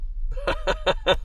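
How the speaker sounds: breathy high giggle; delighted giggle. Real, not acted. Laughs at the find.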